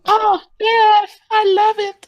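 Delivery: very high, loud falsetto; piercing put-on squeal of delight; mock-ecstatic squeal. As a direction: squeal it in an over-the-top falsetto. Joking mock-ecstatic reaction to crude joke.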